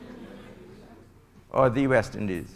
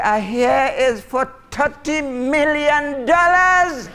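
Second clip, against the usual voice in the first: high, loud, slow proclamation; awestruck announcing; 9 semitones higher, 4 dB louder, much slower than usual. Acted. Neighbours trading fight news at night, loudly proclaiming the prize. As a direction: stretch it out slowly, building on the last word.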